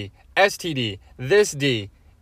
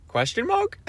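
squeaky question; 12 semitones higher, 4 dB quieter than usual. Real, not acted. Spelled-out joke collapses into laughter.

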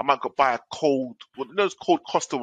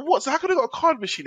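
disbelief; 11 semitones higher than usual. Retold or imitated. Retold disbelief.

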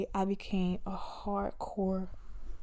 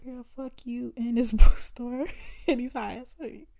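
louder, laughing mock tell-off; dismissive attitude; 3 semitones higher, 11 dB louder than usual. Annoyed shopper imagining telling off the store clerk, laughing dismissive attitude. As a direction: act out sassy attitude with a laugh breaking in. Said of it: "that was my attitude".

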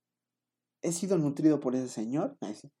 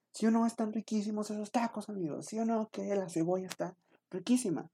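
chatty taco host lady; 7 semitones higher, 4 dB quieter than usual. Retold or imitated. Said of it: "siendo el host de la taquería".

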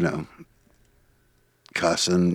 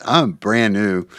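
usual speaking voice

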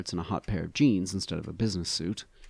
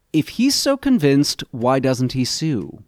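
loud, high, slow and strident; a strident, demanding challenge; 6 semitones higher, 10 dB louder, slower than usual. A narrator's reading. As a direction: push it out hard and sharp, rising in anger. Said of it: "My voice has become strident".

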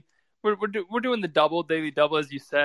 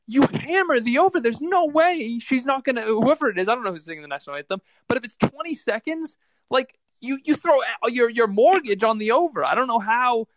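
excited; 6 semitones higher than usual. Real, not acted. Excited betting rant.

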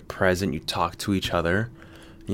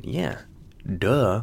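ordinary delivery

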